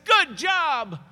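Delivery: loud, high, exclaimed congratulation; mock hearty praise. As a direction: cheer it out as imagined praise. Mock praise from Jesus, then denied.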